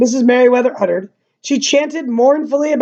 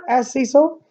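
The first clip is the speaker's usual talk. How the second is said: ordinary delivery, nothing marked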